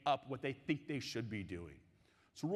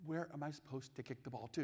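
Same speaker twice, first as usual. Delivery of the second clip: much softer, small innocent voice; little girl; 8 dB quieter than usual. Retold or imitated. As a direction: ask it softly like a puzzled nine-year-old. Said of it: "my nine-year-old little daughter looks up at me and she says".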